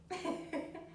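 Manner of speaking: soft breathy giggle; little fond laugh. Real, not acted. Laughs at happy dog.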